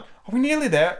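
high, lightly acted breathless question; mildly winded Alice; panting out. A narrator's reading. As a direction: ask high, as if worn out from running. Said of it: "Alice managed to pant out at last".

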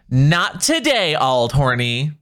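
slow, deliberate "Not today, Auld Horny"; slow example line; example sentence. Shown as a demonstration. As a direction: read it out slowly for the example. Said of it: "I'll use it in a sentence".

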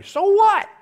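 So what shot up high and loud; mock retort. Preacher answering the reluctant believer, loud and scoffing.

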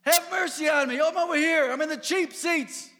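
loud, high desperate shout; yelling out. Retold or imitated. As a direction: shout across the crowd for help. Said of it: "He yells out".

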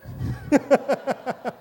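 a high burst of real laughter; bright open chuckle. Real, not acted. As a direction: laugh out loud at your own joke. Pastor laughs at own joke.

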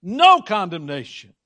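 "No" punched loud, then falling away; forceful stress on "No"; intense emphatic NO. Shown as a demonstration. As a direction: hit "No" hard as an absolute negative. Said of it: "we can't put the intensity to it".

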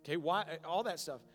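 loud, driving pulpit delivery; preaching at full force. Acted. Pastor preaching end-times signs to the congregation, loud and driving. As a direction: hammer each sign home to the room.

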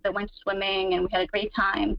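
usual speaking voice

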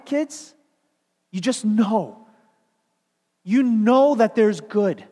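loud, rising preacher's exhortation; preaching at full force. Acted. Preacher rousing young listeners, loud and fervent. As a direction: build the sermon to a loud climax.

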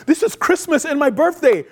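high, giddy little burst; excited Jonah. Retold or imitated. Preacher voicing Jonah's delight over the plant, excited. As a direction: gush like a kid at Christmas. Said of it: "Jonah is so excited".

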